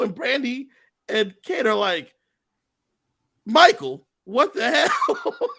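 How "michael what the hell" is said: squealed high through laughter, slow; mock scandalised cry, cracking into a laugh; laughing mock outrage. Brandi and Kate rounding on Michael, a laughing high squeal.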